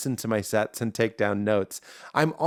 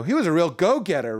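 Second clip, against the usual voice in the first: acted-out joke line; 4 semitones higher than usual. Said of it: "I acted out more, but I go".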